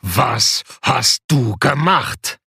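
loom over him and threaten slowly; threatening giant. A narrator's reading. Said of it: "drohte der Riese".

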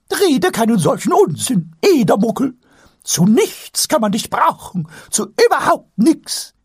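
shrill-voiced scolding; scolding high voice. Scolding reply in character.

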